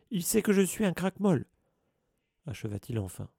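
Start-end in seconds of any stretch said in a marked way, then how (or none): 0.1-1.5 much higher, louder pained cry; anguished outburst. A narrator's reading. Filch finally admits he is a Squib, anguished. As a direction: spit out the shameful word.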